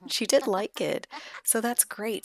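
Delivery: normal talking voice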